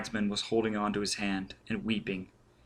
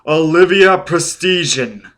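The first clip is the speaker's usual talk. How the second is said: loud, higher, drawn-out name; crying out the name; 6 semitones higher, 9 dB louder than usual. A narrator's reading. Burning Man tells Foyle who gave the order, crying out. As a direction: build to a ringing revelation.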